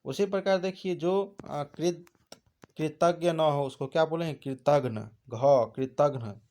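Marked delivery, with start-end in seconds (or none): none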